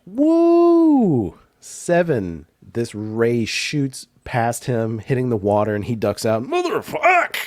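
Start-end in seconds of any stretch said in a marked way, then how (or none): 0.1-1.4 high loud whoop of glee; excited whoop. Real, not acted. Game master cheering a player's dice roll, loud whoop. 6.4-7.4 high, loud startled curse; shark's high curse. Acted. Shark monster cursing as a shot misses, high yelp.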